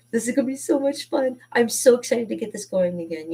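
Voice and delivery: eager, lifted excitement; excited. Real, not acted. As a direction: let excitement lift your voice.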